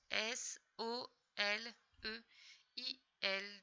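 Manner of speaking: S O L E I L, each letter slowly apart; slow careful spelling for a child; spelled out. Shown as a demonstration. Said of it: "spelling letter by letter".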